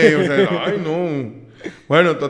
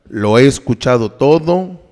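low, slow psychiatrist; deep serious doctor; 7 semitones lower than usual. Retold or imitated. Psychiatrist voiced in retelling.

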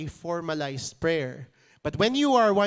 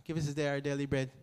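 fast, soft rote line; quick quiet recital; 3 semitones lower, 7 dB quieter, much faster than usual. Shown as a demonstration. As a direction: mutter it quickly as routine. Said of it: "that's how real it is".

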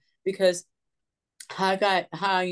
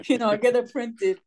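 real laugh running through the words; 4 semitones higher than usual. Real, not acted. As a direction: chuckle it out at yourself. Self-deprecating joke about not being creative.

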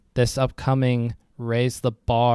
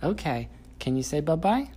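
put-on high, sing-song voice for a small child; reading to a toddler; 4 semitones higher, 6 dB quieter than usual. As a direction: coax a toddler, bright and playful.

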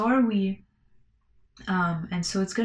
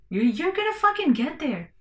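emphatic encouraging burst; 10 semitones higher, 3 dB louder than usual. Real, not acted. Host urging struggling artists, fired-up and much higher. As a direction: pitch jumps much higher on the repeat.

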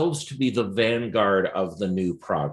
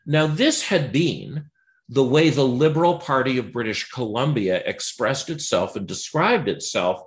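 ordinary talk

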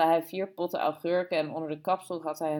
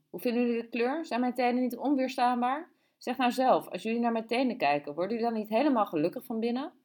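higher-pitched, lilting questions; woman proudly showing her toes; 6 semitones higher than usual. A narrator's reading. Woman asks the children to admire her toes, higher and coaxing. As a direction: voice her fishing eagerly for admiration.

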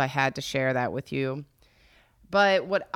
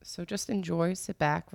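ordinary talk, nothing marked

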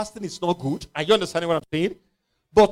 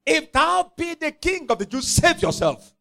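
mocking crowd; 8 semitones higher than usual. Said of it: "They were mocking him and saying".

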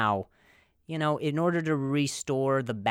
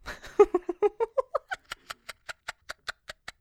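high laugh; 4 dB louder than usual. Real, not acted. Laughing at own joke.

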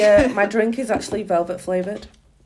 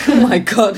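loud wheezing gasp through laughter; laughing wheeze; 7 dB louder than usual. Real, not acted. Laughing so hard she sounds like a dying horse. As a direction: gasp for air mid-laugh. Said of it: "Sound like a dying horse".

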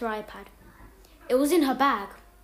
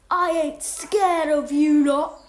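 menacing voice; 4 semitones higher, 8 dB louder, slower than usual. Acted. Reading a threatening note.

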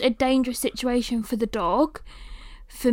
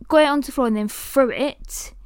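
ordinary delivery

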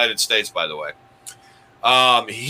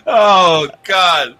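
laughing exclamation; 4 semitones higher, 6 dB louder than usual. Real, not acted. Reacting after laughing.

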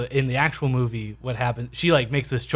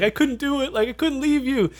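Rose pleading; 11 semitones higher than usual. Retold or imitated. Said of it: "she's like".

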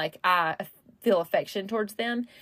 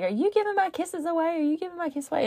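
put-on high, teasing singsong to a little girl; lightly voiced stepdad teasing; 9 semitones higher, faster than usual. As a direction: playfully scold her for giving kisses away. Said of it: "he'd be like".

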